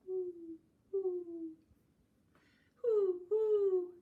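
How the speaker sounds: high, drawn-out hooting; owl hoot. Acted. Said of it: "asked the owl".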